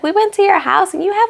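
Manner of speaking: high, put-on girly voice; lightly mocking a nervous friend; imitating a nervous girl. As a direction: mimic her hesitant nervous tone. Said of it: "she's like".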